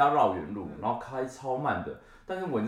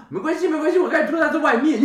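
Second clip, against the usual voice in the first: laughing; 9 dB louder than usual. Retold or imitated. Retold answer to driver, laughing.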